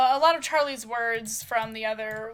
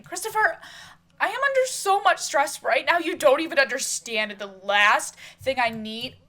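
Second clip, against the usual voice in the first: loud, raised-pitch exasperated tirade; angry outburst; 4 semitones higher, 8 dB louder than usual. Acted. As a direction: erupt at him in an acted quarrel. Acted quarrel, stressed fiancée erupts.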